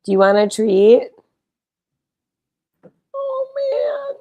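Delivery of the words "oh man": squeaky oh man. Real, not acted. Cooing reaction.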